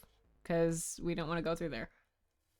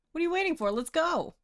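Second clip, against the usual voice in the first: high, eager cheer; excited; 11 semitones higher than usual. Pretend. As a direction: call out like a peppy sidekick. Cheerful game voice imitation.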